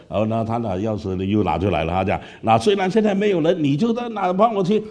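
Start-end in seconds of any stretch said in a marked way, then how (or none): 2.4-4.8 much higher, eager urging; coaxing pastor. Retold or imitated. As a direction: pastor presses the key on him. Pastor offers the key again. (牧师热情地劝)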